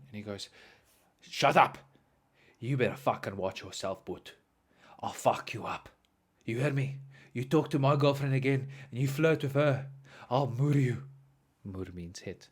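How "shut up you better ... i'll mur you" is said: imitated tough-guy threat; menacing laborer. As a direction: threaten like a burly bully, held back.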